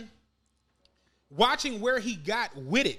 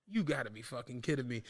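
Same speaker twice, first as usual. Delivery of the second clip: deflated disbelief; 11 semitones lower, 7 dB quieter than usual. Retold or imitated. Game tied at the end.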